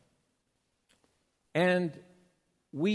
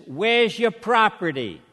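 put-on loud, higher Revere accent; 5 semitones higher, 7 dB louder than usual. Security guy asks traveler for belongings, loud Revere accent. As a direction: demand it in a thick Revere accent. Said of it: "had an accent a lot like mine".

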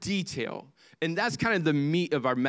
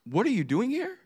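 higher-pitched, pained question; devastated father; 7 semitones higher than usual. Acted. Father asking his lost son, devastated. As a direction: let a father's heartache lift the voice. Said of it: "Can you hear the devastation in the father's voice".

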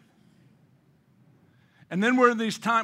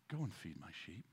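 soft, much softer command; hushed; 12 semitones lower, 22 dB quieter than usual. Acted. Jesus softly sending the believer, hushed. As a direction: murmur it like a gentle sending.

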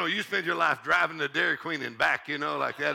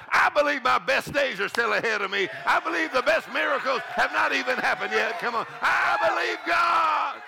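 louder, higher bold proclamation; faith declaration; 7 semitones higher, 3 dB louder than usual. Acted. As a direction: proclaim it like a shout. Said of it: "the spirit of faith says".

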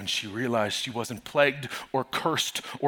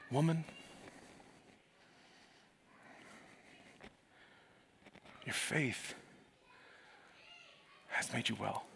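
Jesus blessing the woman softly; tender; 2 semitones higher, 12 dB quieter than usual. Acted. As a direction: lay each word down gently. Jesus speaks tenderly to her.

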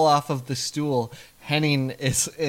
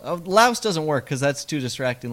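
ordinary delivery

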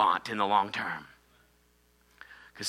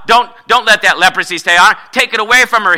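begging God; 7 semitones higher, 10 dB louder than usual. Retold or imitated. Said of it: "begged God".

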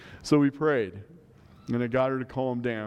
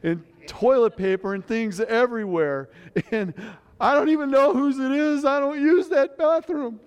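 much higher and louder, frantic daughter voice; imitated panic; 11 semitones higher, 5 dB louder than usual. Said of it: "compared to the way she said it".

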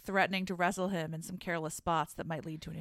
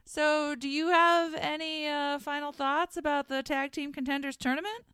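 new voice; 6 semitones higher than usual. Pretend. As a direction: play a prim show host. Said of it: "This is my new voice".

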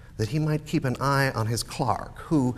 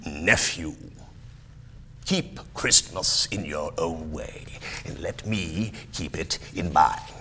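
put-on lower, curt old miser; stern Scrooge; 5 semitones lower than usual. Miser tells nephew to leave him be, stern. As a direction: put the nephew firmly in his place.

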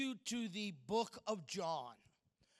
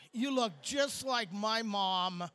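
hammed-up compliment; 2 semitones lower, 7 dB louder, slower than usual. Shown as a demonstration. Hammed-up mom compliment, slow, loud.

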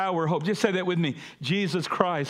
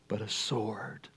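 slow, much softer, whispered; dramatic whisper; 8 semitones lower, 8 dB quieter, slower than usual. Acted. Pastor repeating Jesus' words to the church, slow hushed whisper. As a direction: let it fall to a hush, slowly.

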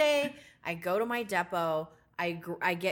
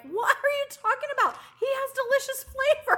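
high mock voice; 6 dB louder than usual. Retold own high voice.

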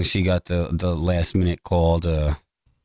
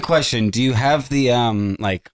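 usual speaking voice

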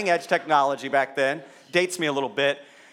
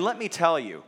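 usual speaking voice

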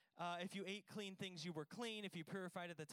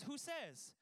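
higher put-on voice, teasing challenge; mock God voice; 6 semitones higher than usual. God teasing Peter about the food law, higher mock challenge. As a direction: toss the question back playfully.

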